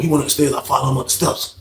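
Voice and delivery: normal talking voice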